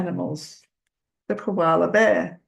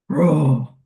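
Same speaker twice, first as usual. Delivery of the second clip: a playful, louder pretend roar; mock lion roar; 3 semitones lower, 6 dB louder than usual. To a child, a playful lion roar. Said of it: "I don't know if I can do a really big roar".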